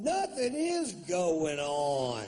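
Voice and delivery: guilty man overselling his innocence; overdone innocence. Pretend. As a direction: insist far too loudly that all is normal. Caught acting innocent for wife.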